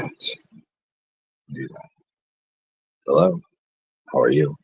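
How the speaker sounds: very slow, breathy low radio croon on "I'll do that". Shown as a demonstration. Vlogger demos his radio voice, very slow and hushed. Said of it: "this is the voice of radio".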